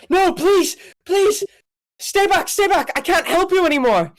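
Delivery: high-pitched, loud pleading yell; panicked yell. Acted. Game master as a voice in the dark, panicked yell. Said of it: "a voice that ... yell out".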